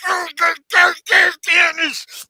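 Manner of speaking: high, loud exasperated yelling; mock rage. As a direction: explode in a hammed-up tantrum. Acted villain raging at his phone.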